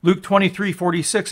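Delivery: ordinary talk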